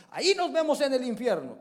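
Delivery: high, loud angry "Ahí nos vemos en el infierno"; angry retort. Retold or imitated. Someone angrily quoted in a quarrel, loud and high. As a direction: fling the curse out in anger. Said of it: "Cuando uno se enoja".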